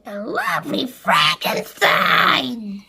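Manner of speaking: slow, loud sneer; mock her cruelly. A narrator's reading. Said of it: "as Spiker said".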